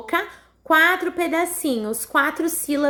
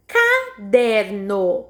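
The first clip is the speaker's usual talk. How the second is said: "caderno" slowly in three beats, ca-der-no; sílabas separadas; 4 semitones lower, much slower than usual. Shown as a demonstration. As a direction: say "caderno" syllable by syllable, lower. Teacher splits word into syllables. (caderno pausado, sílaba por sílaba)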